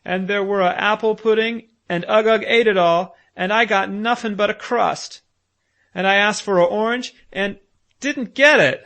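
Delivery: put-on high, loud wailing child's voice; a hurt small boy pouring out woes; aggrieved little Bruno. Bruno's tearful complaint.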